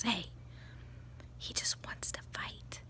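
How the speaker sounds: whispered aside, imitating; hushed, very soft retold aside; confidential whisper. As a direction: whisper it like a private tip-off.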